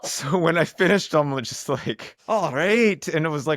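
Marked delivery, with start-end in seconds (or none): 2.3-3.1 strong mock Scottish "All right", high and loud; hammed-up Scottish brogue; a burly Scottish "All right"; Scottish accent. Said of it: "a really strong Scottish accent".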